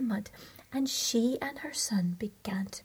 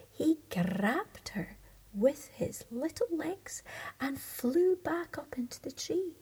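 usual speaking voice

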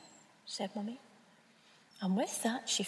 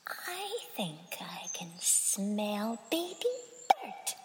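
slow, sly whispered cat voice; 3 semitones lower, much slower than usual. Acted. Cat sniffing for the bird, sly slow whisper. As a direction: purr it like a hungry prowler. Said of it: "he said".

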